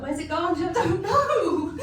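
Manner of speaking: half-laughing baffled gran voice; mock confused exchange. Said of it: "I'm like".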